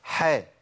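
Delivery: "حاء" with an open vowel, light; ha with fatha. Shown as a demonstration. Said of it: "حاء الفتح فيها إزاي".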